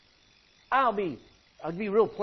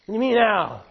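louder, incredulous snap back; indignant shout; 8 dB louder than usual. Acted. Balaam yelling at the talking donkey in indignant disbelief. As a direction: throw the question back in outrage.

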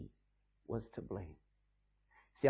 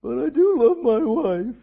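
mock protesting husband; 7 semitones higher, 8 dB louder than usual.

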